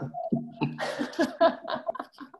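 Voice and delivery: a rising, breathy laugh at being put on the spot; flustered laugh. Real, not acted. Guest put on the spot by the host, flustered laugh. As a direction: laugh it off, caught off guard.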